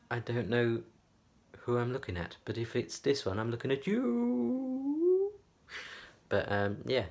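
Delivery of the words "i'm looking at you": squeaky high voice. Pretend. High squeaky tease to camera.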